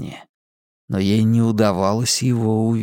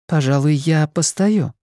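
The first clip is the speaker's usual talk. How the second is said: woman's voice; 4 semitones higher than usual. Acted. Simona refuses.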